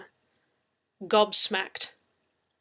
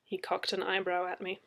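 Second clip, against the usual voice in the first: ordinary delivery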